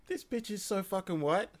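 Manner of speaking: squeaky mock outrage; high incredulous voice. Retold own inner thought.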